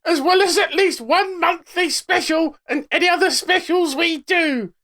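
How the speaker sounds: very high, loud caricature voice; strained, high-pitched announcer send-up; Stiggy. Acted. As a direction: squawk the sales pitch as a mocking impression. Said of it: "it's Stiggy".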